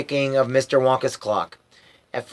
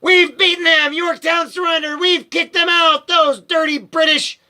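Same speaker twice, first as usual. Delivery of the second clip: shouted; 14 semitones higher than usual. A narrator's reading. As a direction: bellow the victory news from the bed. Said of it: "sat bolted right up in bed and shouted".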